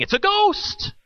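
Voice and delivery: high, frightened cry; terrified cry. Acted. Disciples in the storm-tossed boat screaming in fear. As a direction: yelp it out like they've seen a ghost. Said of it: "they were terrified, saying".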